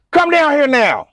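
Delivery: loud, high commanding call; mock cop order. Lady cop ordering trucker down, loud command.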